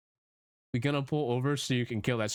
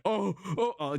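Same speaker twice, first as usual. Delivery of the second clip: mock freaking out; 8 semitones higher than usual. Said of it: "freaking out".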